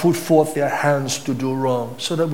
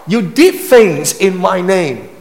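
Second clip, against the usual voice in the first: Jesus rejecting them; 9 semitones higher, 7 dB louder than usual. Acted. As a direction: hurl it down as a final judgment. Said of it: "He said".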